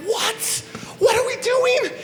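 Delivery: high baffled squeak. Pretend. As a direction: squeak it out, totally lost. Bungled high five.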